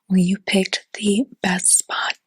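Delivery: ordinary talk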